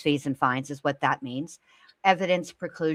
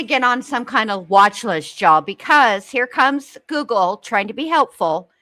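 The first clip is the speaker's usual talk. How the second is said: excited; 6 semitones higher, 6 dB louder than usual. Real, not acted. Joking about Google's search results.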